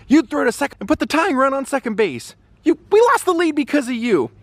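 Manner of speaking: scolding coach. Retold or imitated. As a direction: chew her out like a gruff manager. Coach chewing out player.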